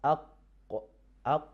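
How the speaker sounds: "aq" with a stopped q and a small echo; qaf demo. Shown as a demonstration. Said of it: "As you say".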